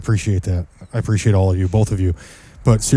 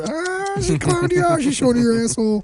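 high, soft cooing voice at the cat; 3 dB quieter than usual. Real, not acted. Owner cooing at the cat on the couch, high and soft.

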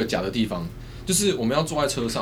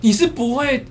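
high, loud, pushy scolding; bossy director yelling; 12 semitones higher, 7 dB louder than usual. Retold or imitated. Female director yelling at the actor on the motorbike to flirt. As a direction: bark the order at the clumsy actor. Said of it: "他就过来讲说".

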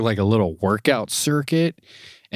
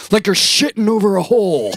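higher, louder coaching shout; Ultimate Warrior imitation; 12 semitones higher, 6 dB louder than usual. Ultimate Warrior showing kids the squat, shouting. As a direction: yell the squat cue at the kids. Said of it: "he's like".

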